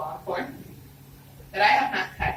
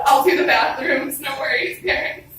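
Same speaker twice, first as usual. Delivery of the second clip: louder, higher, slowed-down pledge; eager promise; 6 semitones higher, 8 dB louder than usual. Retold or imitated. Daughter rehearses her grounding offer to her parents, loud and eager. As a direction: sell it hard to the parents.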